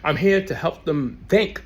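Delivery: ordinary delivery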